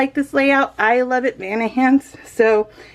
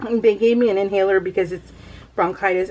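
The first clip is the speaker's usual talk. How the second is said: ordinary talk, nothing marked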